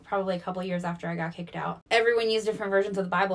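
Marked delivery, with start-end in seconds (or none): none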